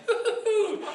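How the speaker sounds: very high, breathless hoots of relief; high-pitched comic "phew"; relieved hooting. Acted. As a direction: hoot like you just got away with it. Relief after hiding the tape.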